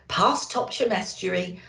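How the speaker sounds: ordinary delivery, nothing marked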